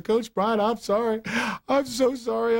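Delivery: imitating a boy sobbing to his coach; retold sobbing plea. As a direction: beg like a crying kid caught out. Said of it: "I shifted into another gear of crying".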